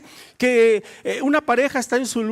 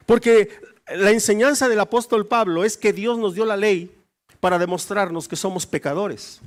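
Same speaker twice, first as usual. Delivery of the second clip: normal talking voice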